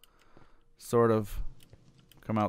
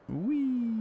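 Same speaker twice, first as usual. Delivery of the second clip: playful squeal; 14 semitones higher, 3 dB quieter than usual. Real, not acted.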